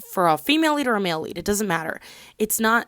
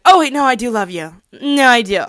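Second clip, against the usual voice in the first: sudden gushing love confession, a bit louder; mock sudden confession; 4 semitones higher, 9 dB louder than usual. Mocking a heroine who suddenly confesses love. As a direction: suddenly blurt out love, hammed-up. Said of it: "spontaneously is like".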